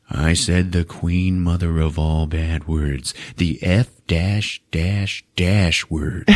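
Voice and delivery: slow, deliberate mock voice-over; inner narrator. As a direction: spell it out solemnly like film narration. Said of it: "in his mind go".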